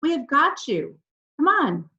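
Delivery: high, loud calls across the hill; calling out. A narrator's reading. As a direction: shout for her to come out. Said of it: "Then we started to call".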